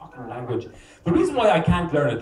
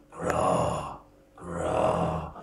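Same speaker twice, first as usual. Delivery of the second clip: much lower, croaked "Gra, gra" like a crow; low croaking; 5 dB quieter than usual. Retold or imitated. Comic mocking Irish love word, low croak. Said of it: "crow sound for Irish".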